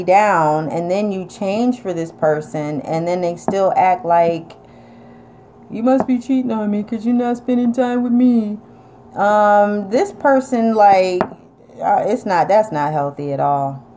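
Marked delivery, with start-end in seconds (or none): 5.7-8.6 accusing partner. Retold or imitated.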